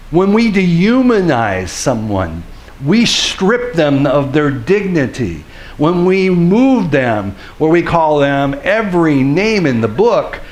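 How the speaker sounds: high, loud impassioned preaching; full-force preaching. Acted. Preacher pleading with the congregation about dignity, loud and impassioned.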